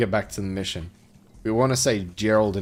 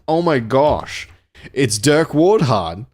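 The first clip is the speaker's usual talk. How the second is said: drawn-out, louder cry of awe from the crowd; slow, excited storybook shout; mock yell; 4 semitones higher, 8 dB louder, much slower than usual. A narrator's reading. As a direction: read it as a hammed-up yell. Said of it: "Someone in the crowd yelled".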